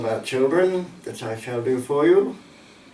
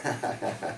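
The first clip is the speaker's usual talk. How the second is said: short, soft, low chuckle; brief quiet laugh; 7 semitones lower, 12 dB quieter than usual. Real, not acted. Chuckles after remark on happier poem.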